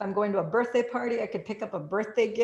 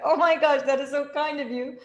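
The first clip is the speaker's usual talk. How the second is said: louder, higher gushing thanks; delighted; 7 semitones higher, 7 dB louder than usual. Retold or imitated. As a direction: gush at the cashier. Thanking the cashier.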